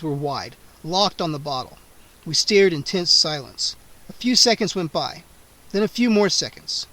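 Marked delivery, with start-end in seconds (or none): none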